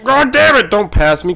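high, loud yelp of annoyance; sudden exasperated yell; angry outburst. Real, not acted. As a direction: shout at the driver passing you. Being overtaken in the game.

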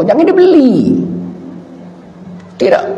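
very high, squealed prohibition; mock parental warning. Preacher acts a parent forbidding firecrackers, high mock scolding. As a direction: forbid it in an exaggerated high pitch.

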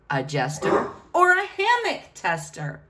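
usual speaking voice

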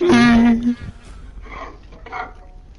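loud laugh. Real, not acted. Teasing over trash talk.

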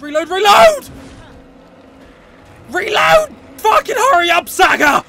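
loud, high pleading yell at the game; frantic shout. Real, not acted. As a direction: yell in real frustration.

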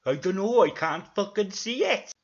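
loud, high, exasperated character voice; loud mock-Welsh indignation; Brian. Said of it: "said Brian".

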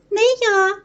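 high-pitched mouse voice; refusing little mouse. A narrator's reading. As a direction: pipe up a drawn-out refusal. Lazy mouse refuses the chore.

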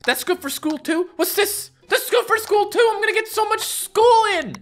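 over-the-top excited character; thrilled avatar. Acted. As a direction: squeal with delight about school. Avatar thrilled about items.

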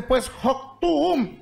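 high, long drawn-out exasperated call; fed up. Acted. Fed-up husband giving in to his wife, exasperated. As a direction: give in with a stretched, exasperated cry. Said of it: "se fastidia el hombre y que le dice".

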